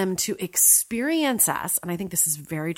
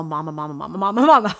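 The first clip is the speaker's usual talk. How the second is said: laughing imitation of insistent children; imitating clamoring kids; 8 dB louder, much faster than usual. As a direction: pester like kids calling for mom, building loud with a laugh. Imitating children calling for their mother.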